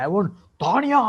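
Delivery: put-on Tanya stretched high and loud in a heavy South Indian accent; over the top South Indian accent. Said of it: "Over the top accent".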